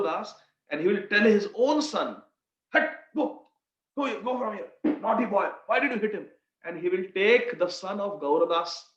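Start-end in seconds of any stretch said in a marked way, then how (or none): 2.7-6.3 mock scolding father. Father scolds his naughty son.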